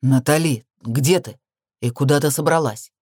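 understated angry interrogation; mild growl. A narrator's reading. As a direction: growl the questions at her. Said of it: "прорычал он".

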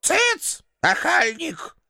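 put-on shrill-free high old woman's rebuke; old landlady. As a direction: shoo him off like a cranky granny. Old landlady scolds hero.

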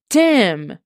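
sharp, scandalised cry of his name; shocked exclamation. Acted. Cassie reacting to the sexts, shocked exclamation. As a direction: call him out in shock. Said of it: "she exclaimed".

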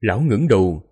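usual speaking voice

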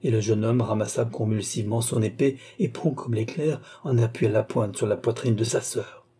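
ordinary delivery

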